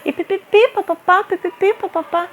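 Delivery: high sing-song babble; mocking gossip noises; mock chatter. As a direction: mimic gossip as nonsense syllables.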